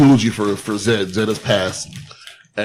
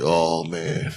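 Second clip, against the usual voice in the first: low, quiet groan of disappointment; deflated, lower and softer; mock dismay; 7 semitones lower, 7 dB quieter than usual. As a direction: sink into a soft, let-down sigh of words. Acting out his let-down reaction.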